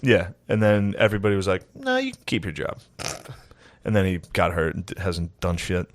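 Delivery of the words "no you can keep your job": high mock voice. Acted team reply, very high.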